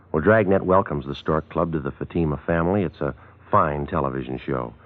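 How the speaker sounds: usual speaking voice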